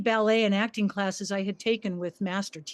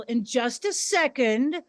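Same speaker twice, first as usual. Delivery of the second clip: plain reading voice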